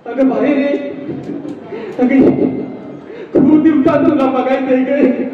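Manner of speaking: usual speaking voice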